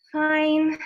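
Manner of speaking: slow, quiet, deflated fine; low-energy fine. Shown as a demonstration. Answering a friend's greeting, flat and drained. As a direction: drag it out with no energy. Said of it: "Slow, quiet".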